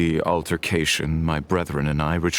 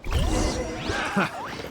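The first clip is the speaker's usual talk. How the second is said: breathy high laugh; 4 dB quieter than usual. Acted. Laughs during fight.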